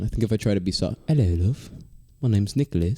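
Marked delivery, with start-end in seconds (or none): 1.1-3.0 British accent. Shown as a demonstration. Podcaster introducing himself, put-on British accent. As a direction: try a lighter British accent. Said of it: "I can't do a deep British accent".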